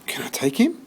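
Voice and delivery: put-on high, hopeful boy's voice; boy begging the shelter lady, high and hopeful; young George. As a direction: ask it like a boy who hardly dares hope. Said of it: "George asks the lady".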